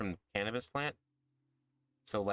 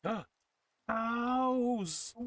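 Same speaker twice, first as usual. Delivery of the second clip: excited shout; 13 semitones higher, 5 dB louder than usual. Real, not acted. Finally spots the wanted cows.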